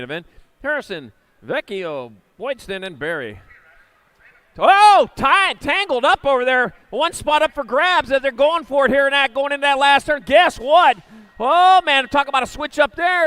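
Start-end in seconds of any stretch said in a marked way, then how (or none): 4.6-13.3 loud, high-pitched excited announcer; big "oh" into a booming play-by-play; excited race call. Real, not acted. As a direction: call the pile-up as it happens, full voice. Live race call of tangled riders.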